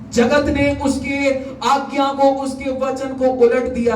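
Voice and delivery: high, slow proclamation; preaching. Real, not acted. Preacher declares the world overturned God's word, high and slow.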